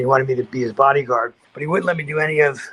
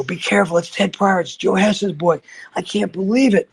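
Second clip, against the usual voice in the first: alarmed disbelief; 5 semitones higher than usual. Retold or imitated. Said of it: "They go".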